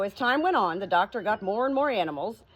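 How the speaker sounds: ordinary delivery, nothing marked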